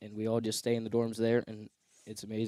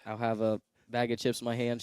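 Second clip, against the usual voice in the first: normal talking voice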